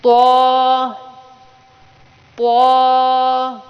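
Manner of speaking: طا and ظا said heavy and full-mouthed; heavy letters. Shown as a demonstration. Teacher drilling heavy letters for pupils. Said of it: "موٹا پڑھنا ہے، یعنی منہ بھر کے پڑھنا ہے".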